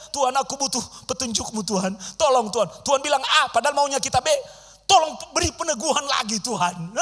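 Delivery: mock pleading prayer. Imitating begging Christians.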